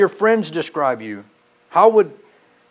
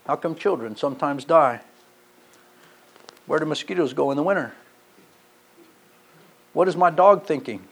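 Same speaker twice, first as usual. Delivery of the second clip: ordinary talk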